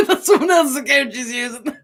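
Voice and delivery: high, loud, laughing through the words. Real, not acted. Telling viewers about best friend's account, laughing. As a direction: crack up while explaining.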